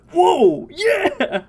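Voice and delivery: high, laughing whoa yeah; delighted high-pitched whoa; excited whoa. Retold or imitated. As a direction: gasp in delight at the sound. Excited reaction to sound design.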